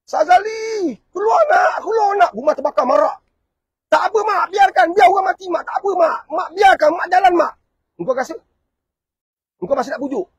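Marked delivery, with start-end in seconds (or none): 0.1-3.2 much higher and louder, crying out to her son; frantic mother screaming. Acted. Mother calls son from fire. 3.9-7.5 high and loud, brushing the mother off; defiant child shouting. Acted. Child refuses mother's rescue.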